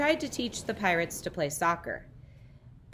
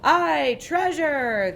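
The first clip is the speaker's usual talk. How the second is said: loud, high cheer; pirate crew shout; 8 semitones higher, 6 dB louder, much slower than usual. A narrator's reading. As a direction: roar it like greedy pirates. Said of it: "the others shouted".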